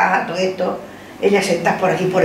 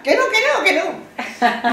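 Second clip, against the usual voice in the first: high, laughing no no no; laughing refusal; 10 semitones higher, much slower than usual. Retold or imitated.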